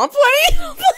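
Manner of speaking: high, loud squeal of laughter; laughing retraction. Real, not acted. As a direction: squeal it through laughter, only joking. Takes back a joke price.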